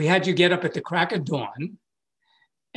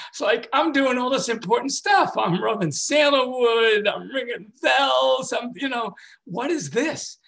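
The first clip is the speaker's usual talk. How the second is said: higher, louder mock outrage about the rituals; self-mocking indignation; 9 semitones higher, 3 dB louder than usual. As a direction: play up your own self-importance, nearly shouting. Mocking his own rituals.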